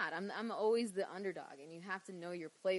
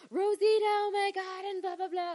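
very high, loud mock squeal; gushing excitement; 12 semitones higher, 10 dB louder than usual. Mother thrilled about TNA, imitated excited gushing. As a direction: gush like a thrilled mom, much higher.

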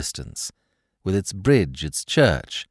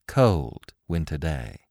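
ordinary delivery

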